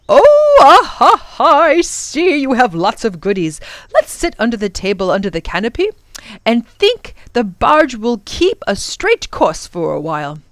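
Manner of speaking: put-on hearty, lively old-fashioned professor greeting; jovial professor.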